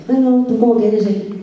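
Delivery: high, pinched little-mouth voice; mock prim question, lips pursed small; tiny-mouthed voice. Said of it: "con la boca así como muy pequeñita".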